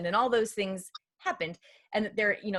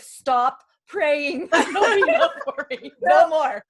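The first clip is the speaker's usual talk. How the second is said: laughing plea; 6 semitones higher, 10 dB louder, slower than usual. Retold or imitated. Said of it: "And I told Elizabeth".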